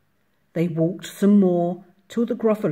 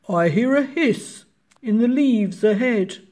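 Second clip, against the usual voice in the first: put-on monster voice, slightly higher; Gruffalo; 5 semitones higher, 6 dB louder than usual. A narrator's reading. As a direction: speak as a wary storybook monster. Monster hears something in story.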